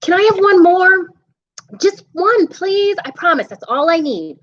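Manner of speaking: put-on pleading little boy at bedtime, voice up high; a kid bargaining to stay awake; high child voice.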